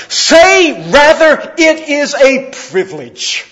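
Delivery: high, loud, slow ringing proclamation; fervent preaching. Acted. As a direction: thunder his words to the congregation. Preaching Livingstone's words.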